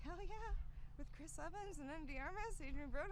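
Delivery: gushing to the director about the offer, excited; retold thrilled reply. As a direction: replay your own overjoyed yes. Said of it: "And I was like".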